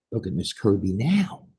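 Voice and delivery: ordinary delivery, nothing marked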